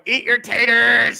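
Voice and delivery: loud, drawn-out mock holler; catchphrase yell. Host demonstrating the line wanted for the intro, drawn-out yell. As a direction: belt out the catchphrase slowly.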